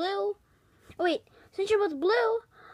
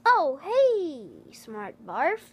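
ordinary talk, nothing marked

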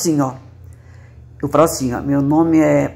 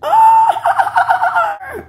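shrieking, very high neigh; whinny like a horse. Shown as a demonstration. Woman showing how the horses neigh, very high and shrieked. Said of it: "relincham assim".

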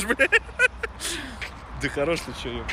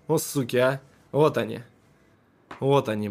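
ordinary talk, nothing marked

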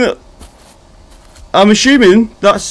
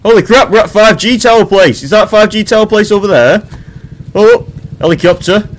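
usual speaking voice

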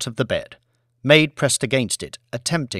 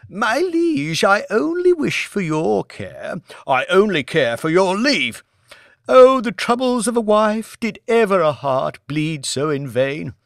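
high melodramatic sighing lament; theatrical wife; 8 semitones higher than usual. A narrator's reading. Wife laments to her husband in high mock-tragedy. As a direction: swoon through it like a stage heroine. Lady Elizabeth mock-Shakespearean.

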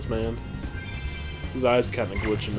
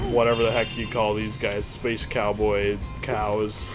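usual speaking voice

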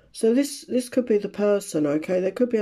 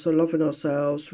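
ordinary talk, nothing marked

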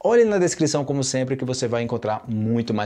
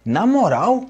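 high, louder, drawn-out disbelief; acted astonishment, pitched well up; incredulous questioning; 7 semitones higher, 4 dB louder, slower than usual. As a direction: question it as if you can't believe it. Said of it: "coisa que eu não acredito".

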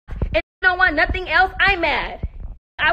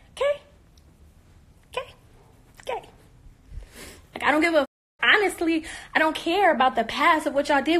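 usual speaking voice

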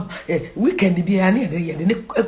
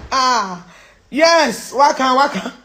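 usual speaking voice

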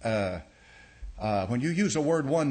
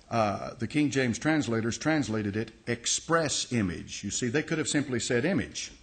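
ordinary talk

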